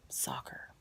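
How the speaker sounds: breathed out on a soft whisper; hushed reveal. Retold or imitated. Reading the contact name on her phone, whispered. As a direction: drop to a whisper for the punchline.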